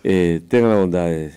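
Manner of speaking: a hint of an old-school teacher seating the class; mock schoolteacher. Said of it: "Así era en la escuela y en el colegio".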